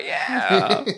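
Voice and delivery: high teasing imitation, rising; drawn-out high-pitched yeah; mock battle cry. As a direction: mimic the friend's battle cry. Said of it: "That's your battle cry".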